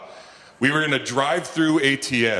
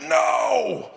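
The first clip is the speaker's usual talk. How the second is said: horrified no. Retold or imitated. Sees his favourite stuffing as bucket.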